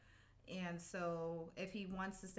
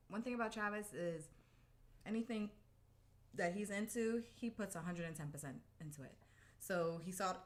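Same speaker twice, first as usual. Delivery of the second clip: normal talking voice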